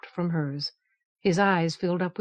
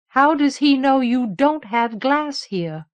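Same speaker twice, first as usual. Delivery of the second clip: high, loud, slow and deliberate question; bold question; 6 semitones higher, 6 dB louder, slower than usual. Acted. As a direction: ask it slowly, taking the risk. Teresa voices a risky question.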